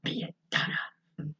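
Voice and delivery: hushed mock threat; unvoiced dog's threat; whispered menace. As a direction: breathe it like a sinister dog. Cujo answering Donna.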